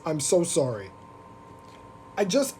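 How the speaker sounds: ordinary delivery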